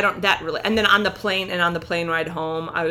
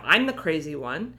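normal talking voice

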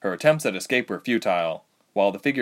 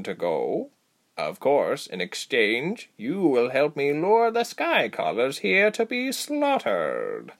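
slow, swooping creature voice; deliberate, commanding, drawn-out words; Saki; 3 semitones higher than usual. Acted. Creature guide bargaining with the captives, commanding. Female creature introduces herself.

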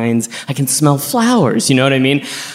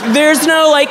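higher shouted outburst; mock shouted retort; 13 semitones higher, 3 dB louder, slower than usual. To the flight attendant, shouted higher outburst. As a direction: shout it back at them. Repeating the rant louder.

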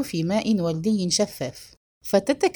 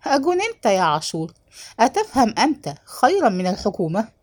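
ordinary delivery, nothing marked

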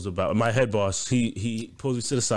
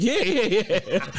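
imitated eager boss; 10 semitones higher than usual. Retells boss's eager confirmation.

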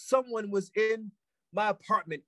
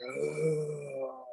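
long, soft mock zombie moan; low, drawn-out zombie groan; weird zombie groan; 3 semitones lower, 7 dB quieter than usual. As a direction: imitate a zombie droning wordlessly. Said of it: "It sounds weird, but he was like".

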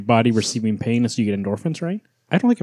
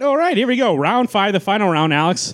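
game show announcer; 9 semitones higher than usual. Acted. Host announces final round.

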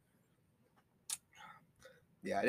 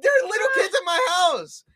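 flustered; 11 semitones higher, 6 dB louder than usual. Real, not acted. Defending himself, flustered.

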